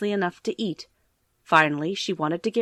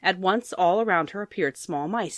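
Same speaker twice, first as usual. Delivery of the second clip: ordinary delivery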